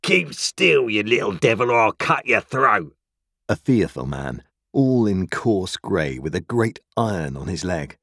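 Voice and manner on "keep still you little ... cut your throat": menacing convict, raised and higher; terrible voice. A narrator's reading. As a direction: cry out a violent threat at a child. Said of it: "cried a terrible voice".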